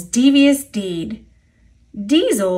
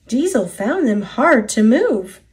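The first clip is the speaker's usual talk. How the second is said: ordinary delivery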